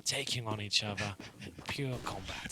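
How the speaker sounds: hushed, low documentary narration; nature documentary voice. Pretend. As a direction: narrate it like a wildlife film. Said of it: "nature documentary voice".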